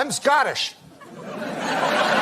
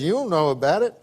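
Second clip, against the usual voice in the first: Southern bartender; 4 semitones lower than usual. Retold or imitated. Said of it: "I can tell by the accent".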